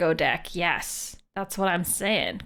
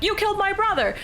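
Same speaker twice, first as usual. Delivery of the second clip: mock accusing sailor; 12 semitones higher, faster than usual. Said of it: "One guy is like".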